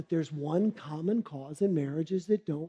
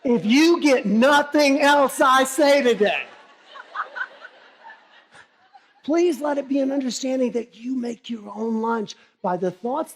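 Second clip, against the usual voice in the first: emphatic punchline; 5 semitones higher, 7 dB louder than usual. Acted. Punchline then sermon lesson.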